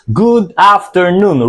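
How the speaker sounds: slow, careful "Good afternoon"; model pronunciation. Shown as a demonstration. Teacher models "Good afternoon" for students, slowly. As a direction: say "Good afternoon" clearly for repetition. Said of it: "Repeat after me".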